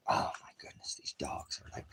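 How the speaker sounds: soft whispered aside. Real, not acted. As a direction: breathe it out under your breath.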